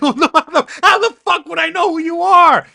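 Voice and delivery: high, loud disbelieving yell with a laugh; incredulous shout. Retold or imitated. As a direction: yell back in mock disbelief at an offended fan. Retelling a fan getting mad.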